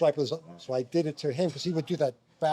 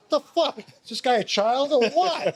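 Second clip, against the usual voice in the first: high-pitched, indignant retold outburst; exasperated complaint; 10 semitones higher, 4 dB louder than usual. Player on one knee turning to coach Phil, exasperated complaint. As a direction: protest to the coach in hammed-up disbelief.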